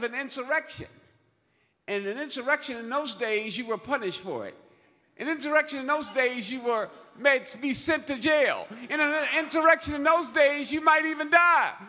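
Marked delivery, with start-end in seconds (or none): 5.2-11.7 rising louder and higher to a shout; building to a shout. Real, not acted. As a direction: build each repeat into a preaching shout.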